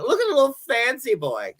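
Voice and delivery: high, quick jeering voice; mocking taunt. She pretends to jeer the husband in the street, high mocking taunt. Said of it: "that's what I would have been saying".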